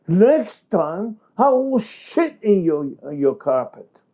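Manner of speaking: high, heated threat; threatening taunt. Shown as a demonstration. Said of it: "and says to him".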